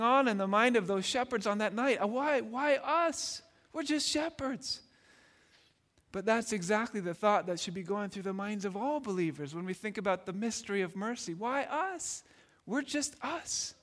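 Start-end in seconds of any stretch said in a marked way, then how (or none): 2.0-4.8 humble wonder. Retold or imitated. Shepherds wondering why they were chosen, humbled. 11.3-13.7 higher, hushed, slowed disbelief; humble wonder. Retold or imitated. Believers asking why God accepted them, hushed wonder.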